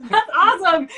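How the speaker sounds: laughing. Real, not acted. Laughing at the helium voice.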